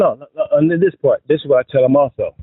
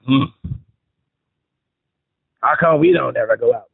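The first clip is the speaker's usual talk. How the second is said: low, sulky complaining wife; a put-on sulky spouse whine; mean-mugging wife voice; 3 semitones lower than usual. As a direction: grumble it like a fed-up wife. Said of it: "See how I did the voice?".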